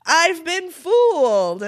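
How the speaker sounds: high, drawn-out melodramatic lament; mock dismay. Tricked boy realises the promise meant nothing, mock-dramatic dismay. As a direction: wail it slowly, hammed up.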